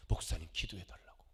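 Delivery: low whisper, confiding a secret plea; whispered request. Retold or imitated. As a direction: breathe it like a hidden request. (목사에게 몰래 부탁하는 낮은 속삭임)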